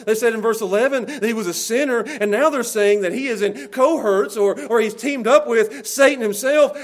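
loud, driving pulpit delivery; full-force preaching. Acted. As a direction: preach it hard to the congregation.

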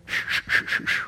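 unvoiced shushing like erasing; eraser rubbing noise. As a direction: wipe it clean with shh sounds. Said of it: "Jesus took the eraser and went".

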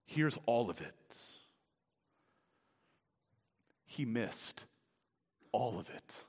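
soft, drawn "all of it" with long pauses; quiet stress on all. Shown as a demonstration. Preacher hushes to stress "all" before building up. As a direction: let "all" hang quietly. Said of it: "emphasis here on the word all".

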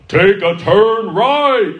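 high, loud, drawn-out navigation command; blaring GPS voice. Retold or imitated. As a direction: blare it like a satnav turned all the way up. Imitates GPS at full volume.

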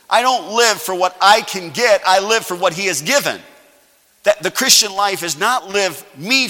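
loud, high, driving sermon; fervent pulpit preaching; full-force preaching. Acted. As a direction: preach it hard to the congregation.

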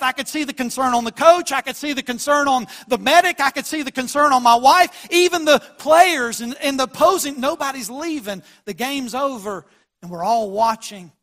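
high, loud sermon delivery; fervent pulpit voice; impassioned preaching. Acted. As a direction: preach it out to the whole church. Preaching at full force.

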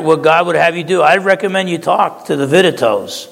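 ordinary talk, nothing marked

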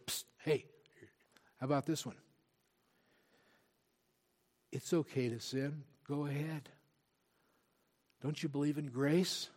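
a soft, sly tempter voice, hushed; tempter whispering. Retold or imitated. Preacher voicing the enemy tempting the flock, soft sly coaxing. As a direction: coax them into sin like a quiet tempter. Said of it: "whisper into your ear and go".

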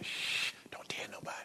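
secretive whisper, no voice at all; hushed whisper. Pretend. Pastor whispering to a joyless churchgoer to keep quiet. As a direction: hush them like sharing a secret.

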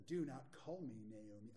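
low, far-off witchy tone. Acted. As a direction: speak it like an old witch. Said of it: "I feel like she had, like, a witchy voice".

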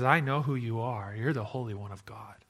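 low menacing demon. Retold or imitated. Demon voiced low and quiet.

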